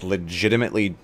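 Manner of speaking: normal talking voice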